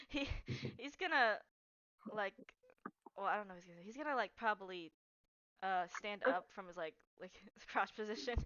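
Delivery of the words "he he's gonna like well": usual speaking voice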